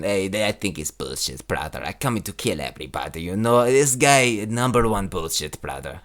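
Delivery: put-on very low, soft, thickly accented fighter; Khamzat Chimaev impression. Comic ranking his fighter impressions, low accented Chimaev impression. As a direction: drop right down into a quiet, menacing accented growl. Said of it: "crazy by my Chimaev impression".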